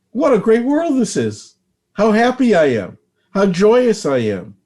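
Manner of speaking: high, bright-hearted exclamation of joy; joyous. Shown as a demonstration. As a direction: gush with delight at the world. Demonstrating ecstatic joy.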